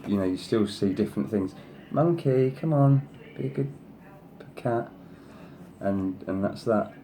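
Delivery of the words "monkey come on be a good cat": high, sing-song pet voice; pet-talk, clearly higher than her own voice; coaxing the cat. Real, not acted. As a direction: coo at the cat to settle it. Calling the cat to behave.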